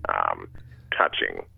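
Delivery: halting dad giving an embarrassing talk; Eugene Levy impression. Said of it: "you do another character from that film".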